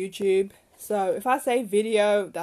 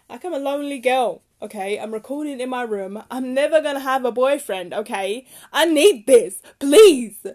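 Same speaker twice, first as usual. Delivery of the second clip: pleading; 4 semitones higher, 11 dB louder than usual. Real, not acted. Lonely podcaster begging listeners to interact.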